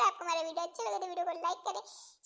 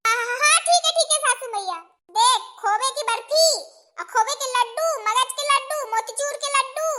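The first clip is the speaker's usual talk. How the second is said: a higher, scolding mother-in-law voice; bossy mother-in-law; 4 semitones higher, 8 dB louder than usual. Acted. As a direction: lay down the law like a village mother-in-law.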